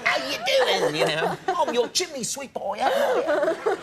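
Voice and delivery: high, loud hammed-up Cockney accent; mock Cockney accent. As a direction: do a bad American's Cockney, Mary Poppins style. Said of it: "Mary Poppins kind of".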